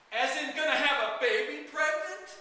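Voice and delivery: loud, high-pitched incredulous question; flabbergasted. A narrator's reading. Father learns daughter is pregnant, flabbergasted. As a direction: blurt it out way up high in disbelief. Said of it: "I was flabbergasted".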